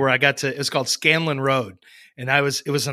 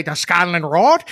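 hammed-up leprechaun Irish; over-the-top Irish accent; 4 dB louder than usual. Shown as a demonstration. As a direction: overdo the Irish brogue, cartoon style. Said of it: "more than like this".

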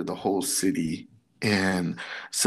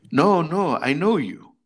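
louder, higher mock insistence; imitated insistence; 6 semitones higher, 4 dB louder than usual. Huge stranger insisting he knows the seated man, imitated insistence. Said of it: "He was, like, insistent".

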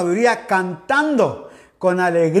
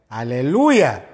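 ordinary talk

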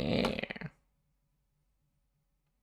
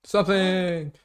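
loud shout; 8 semitones higher, 4 dB louder, much slower than usual. Shown as a demonstration. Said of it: "All right, say something".

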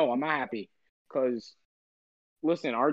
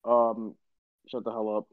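lower, sleepy, dragged-out tone; groggy morning voice; 4 semitones lower, 4 dB louder than usual. Real, not acted. Host just woken up, talking trade news in a groggy low voice. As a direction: sounds like he just woke up and is down about it. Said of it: "I've never heard you this depressed".

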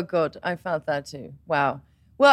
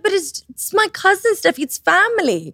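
higher, protesting excuse; defensive mom; 10 semitones higher than usual. Retold or imitated. Mother excusing telling the cousins, raised defensive protest. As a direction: plead innocence as a retold mother.

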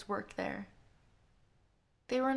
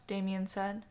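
usual speaking voice

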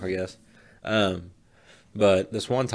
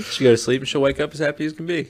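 usual speaking voice

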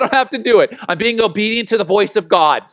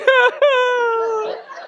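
high laugh breaking into a sob; half laugh, half cry, overwhelmed. Real, not acted. Overwhelmed after the money commitment.

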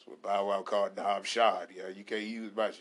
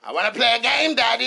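put-on high, loud creepy child voice; a kid doing the Saw line; Jigsaw daughter; 12 semitones higher, 14 dB louder than usual. Daughter quoting Jigsaw at daddy, high and loud. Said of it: "you jigsaw".

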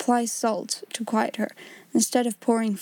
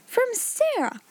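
high, falling cry of surprise; astonished exclamation; 13 semitones higher, slower than usual. A narrator's reading. Pupils hear who wrote the letter, astonished exclamation. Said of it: "Every voice joined in that exclamation".

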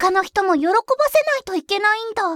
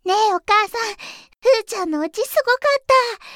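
usual speaking voice

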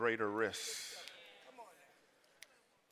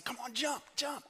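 high-pitched urging, acting out a dad; coaxing father; 11 semitones higher, faster than usual. Father at the bottom of the steps, coaxing. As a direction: coax them to jump, voice raised high.

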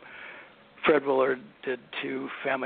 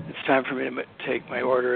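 ordinary talk

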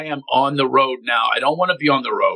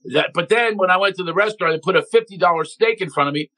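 ordinary talk, nothing marked

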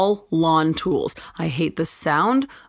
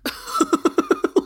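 laugh. Real, not acted. Laughing at her own logic.

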